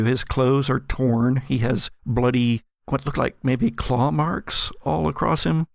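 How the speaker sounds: usual speaking voice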